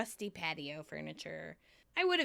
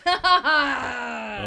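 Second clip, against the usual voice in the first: sarcastic laugh; 4 semitones higher, 12 dB louder, much slower than usual. Retold or imitated. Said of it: "Chandler goes".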